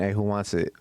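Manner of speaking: ordinary talk, nothing marked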